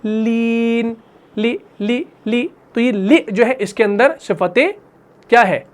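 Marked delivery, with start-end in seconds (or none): 0.0-2.5 لی said thin and light, repeated; لی drilled with a fine, thin ل; thin tarqeeq. Shown as a demonstration. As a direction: lighten the ل in لی each time. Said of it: "تو ہم اس کو کیسے پڑھ رہے ہیں؟".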